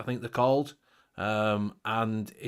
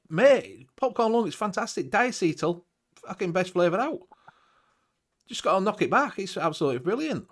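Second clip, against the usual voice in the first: sarcastic; 7 semitones higher than usual. Acted. Sarcastic praise of popcorn lung.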